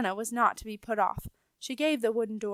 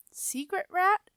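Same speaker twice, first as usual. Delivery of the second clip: blank surprise; 9 semitones higher, slower than usual. A narrator's reading. Rat feigns ignorance.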